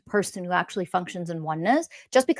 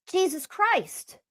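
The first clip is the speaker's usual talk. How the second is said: exasperated sigh; 9 semitones higher, 4 dB quieter, slower than usual. Real, not acted. Exasperated aside.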